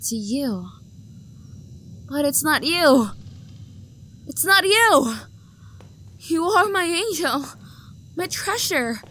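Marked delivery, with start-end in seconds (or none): 4.3-5.2 higher, louder, near breaking; a stalker's pained outburst; anguished cry. Acted. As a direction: cry out the loss. Repeats, more desperate.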